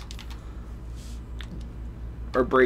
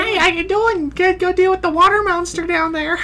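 squeaky high cartoon voice. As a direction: chirp it in a tiny voice. Voicing a game character reply.

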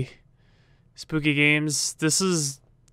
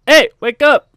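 shout; 9 semitones higher, 11 dB louder than usual. Acted. Yelling to wake sleeping student.

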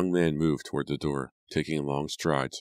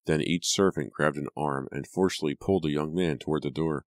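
ordinary delivery, nothing marked

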